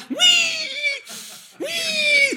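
squeaky high nose-whistle sound; high whistling wee. Retold or imitated. Imitating someone breathing through a whistling nose with a loud squeaky wee. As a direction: squeal like air through a party favor.

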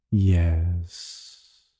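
low, soft purr. A narrator's reading. Thief purring at the merchant over the ruby, low purr. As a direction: purr the word slyly. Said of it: "purred Radek".

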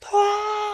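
high, drawn-out yelp; scream of pain. Acted. Bear bitten by hawthorn spines, acted pained scream. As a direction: let out a long high howl of pain. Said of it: "he just screams out in pain".

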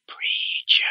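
hushed, eerie whisper of one word; creepy whisper preacher voice. Retold or imitated. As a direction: breathe the name out like a creepy preacher. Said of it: "like, whisper preacher voice".

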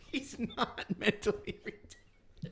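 slow, breathy through laughter; forcing words out while laughing. Real, not acted.

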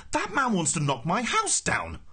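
high, drawn-out protest; exasperated shout. A narrator's reading. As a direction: wail the protest in outrage. Arthur's shouted protest continues.

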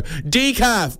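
high, carrying barista holler; mock loud announcement. Barista exposes the decaf drinker, high mock announcement. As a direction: call it out to shame the customer. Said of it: "they say loud enough so that the bush turkeys".

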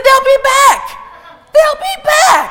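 high, loud triumphant cry; soaring pulpit holler; preacher's shout. Retold or imitated. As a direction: shout it out at the sermon's peak. Climax of preaching, quoting the Lord.

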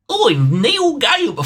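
high, slow mock British drawl; exaggerated fake British accent; bad Neil Gaiman accent. Sick of podcasters doing the author, mocking British accent. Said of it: "do a sort of accent for Neil Gaiman".

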